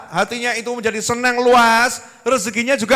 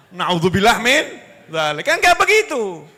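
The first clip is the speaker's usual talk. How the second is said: ordinary talk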